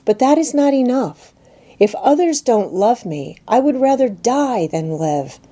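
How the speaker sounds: put-on a desperate child who needs to be loved; girl pleading to her friend for love; young Jane, impassioned. Jane's passionate outburst.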